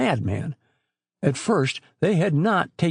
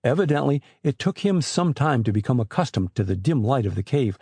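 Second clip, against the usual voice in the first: ordinary delivery, nothing marked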